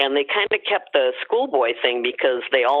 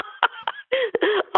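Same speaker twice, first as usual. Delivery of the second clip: high burst of laughter. Real, not acted. Laughs after segment flew by.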